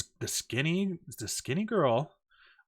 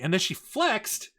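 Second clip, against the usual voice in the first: ordinary talk, nothing marked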